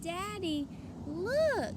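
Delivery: high eager call; little girl showing off her cart; excited child. A narrator's reading. Taya shows her father the candy, excited.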